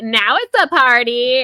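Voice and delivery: loud, high, imitated excitement; mock party cheer. Margaret as drinking starts, mock excited cheer. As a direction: hype up the party in a mocking imitation.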